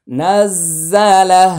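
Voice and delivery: slow, drawn-out chanted recitation; Quran recitation. Shown as a demonstration. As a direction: recite it with long melodic stretch. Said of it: "recites word after pause". (ধীরে সুর করে তিলাওয়াত)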